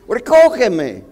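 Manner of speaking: fuerte y agudo, exigiendo; grítale al bus que no para; grito de reclamo. Retold or imitated. Grita al bus que pasa. (grito exigente al chofer)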